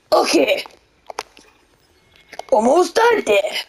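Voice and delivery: normal talking voice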